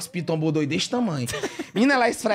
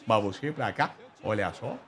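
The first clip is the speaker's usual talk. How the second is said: much lower, slow, softer show-host voice; lower, slow, hammed-up game-show host; Silvio Santos impression; 11 semitones lower, 5 dB quieter, slower than usual. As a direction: channel the TV host greeting a guest. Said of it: "incorporei na mesma hora".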